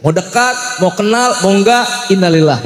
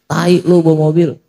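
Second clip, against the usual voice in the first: mock rude insult. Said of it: "Apa dia bilang?".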